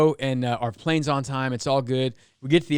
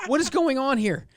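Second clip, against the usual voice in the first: amused disbelief; 10 semitones higher than usual. Retold or imitated.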